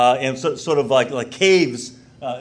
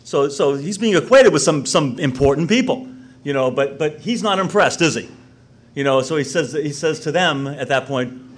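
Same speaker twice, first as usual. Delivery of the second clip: ordinary delivery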